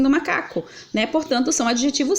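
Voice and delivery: ordinary talk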